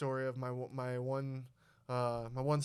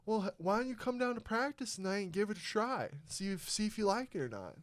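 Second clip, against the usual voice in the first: higher, easygoing coach invite; coach's voice; 6 semitones higher than usual. Retold or imitated. Coach inviting the kid to practice, higher and relaxed. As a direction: pitch it up like a friendly coach. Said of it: "And he's like".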